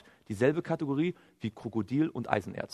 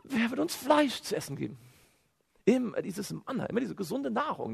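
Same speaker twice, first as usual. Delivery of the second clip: mock whining complaint, high-pitched; weinerliches Jammern; 9 semitones higher than usual. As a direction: imitate a crowd moaning for better food. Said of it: "wieder zu weinen". (weinerlich nachgeahmtes Gejammer)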